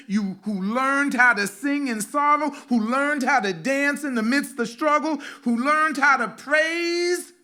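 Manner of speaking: loud, high, impassioned preaching; preaching at full force. Acted. Pastor preaching to the congregation about ancestors, loud and fervent. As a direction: lift the room with rising fervor.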